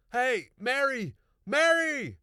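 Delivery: high loud call across the yard. A narrator's reading. Farmer calling his wife from the garden, loud high call. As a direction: shout her name to bring her running. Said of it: "started calling to his wife".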